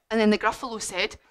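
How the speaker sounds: plain reading voice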